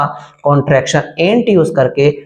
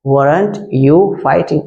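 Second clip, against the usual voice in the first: "weren't you fighting" stretched out slowly; slow clear repeat; much slower than usual. Shown as a demonstration. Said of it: "said twice, normal then slow".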